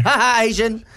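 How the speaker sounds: sudden high blurted tic; mock Tourette's tic yell. Retelling his tic at the museum, a sudden high blurt. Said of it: "pointed at her, and went".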